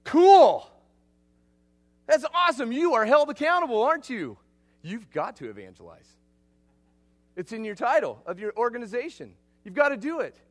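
loud, high, thrilled exclamation; excited friend. Retold or imitated. Australian friend hearing the acronym, loud and thrilled. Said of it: "And he goes".

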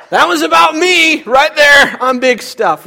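high, loud and slow bragging; cocky boast. Retold or imitated. Preacher imitating a player claiming the credit, loud boast. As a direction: strut through it, all about me. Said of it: "what did he do?".

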